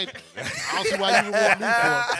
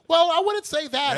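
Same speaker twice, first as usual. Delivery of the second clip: lifted, slow mock protest; a man on a train objecting in a raised, slowed voice; higher mock voice; 10 semitones higher, 7 dB louder than usual. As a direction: imitate his high, slow protest. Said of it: "he claimed".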